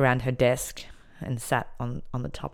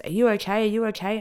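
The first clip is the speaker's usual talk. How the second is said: higher, urgent voices calling over; worried calling out; 8 semitones higher than usual. Retold or imitated. Other women on balconies to inmate, worried calling out. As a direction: call out in concern, high and quick.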